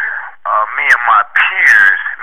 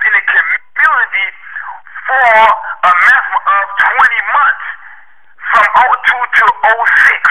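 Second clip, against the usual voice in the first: raised, heated voice, higher and building to a shout; angry; 5 semitones higher than usual. Real, not acted. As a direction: hammer the host with growing outrage, voice cracking near tears. Caller angrily confronts the radio host.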